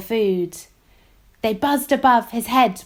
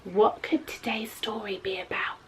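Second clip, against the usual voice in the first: soft secret whisper; hushed mystery whisper; 8 dB quieter than usual. Acted.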